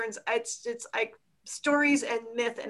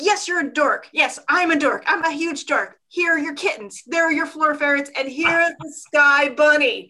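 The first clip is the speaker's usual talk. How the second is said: excited; 4 semitones higher, 6 dB louder than usual. Retold or imitated.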